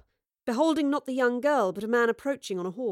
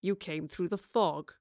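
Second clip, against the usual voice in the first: low, slow man's voice; cool sly villain; 4 semitones lower, 4 dB quieter than usual. Acted. Man sizing up a girl once alone with her, cool menace. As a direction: play him low and unhurried, coolly knowing.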